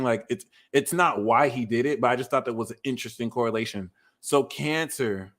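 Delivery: normal talking voice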